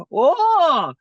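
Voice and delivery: very high, loud creature voice; high-pitched gleeful monster, drawing out the words; giddy laughing head. Acted. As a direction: squeal with mocking delight. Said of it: "laughing one's like".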